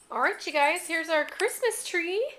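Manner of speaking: ordinary delivery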